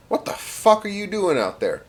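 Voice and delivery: high-pitched exasperated challenge; incredulous demand. Acted. German officer to his stray soldier, high incredulous demand. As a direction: demand an answer, pitch jumping up.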